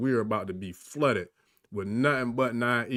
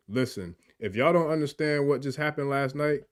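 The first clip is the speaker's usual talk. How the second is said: ordinary delivery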